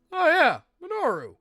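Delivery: ordinary talk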